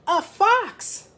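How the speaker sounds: high-pitched mock alarm; story shout. A narrator's reading. Farmer spotting a fox, mildly acted shout. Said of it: "shouted the farmer".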